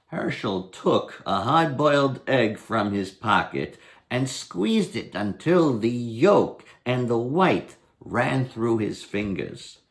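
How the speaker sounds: ordinary delivery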